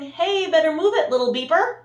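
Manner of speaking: high loud holler. Acted. Street sweeper hollering at Little Blue, high loud holler.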